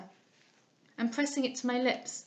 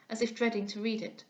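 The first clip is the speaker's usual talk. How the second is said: ordinary delivery, nothing marked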